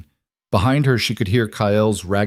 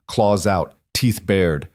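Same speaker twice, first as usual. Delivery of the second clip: ordinary delivery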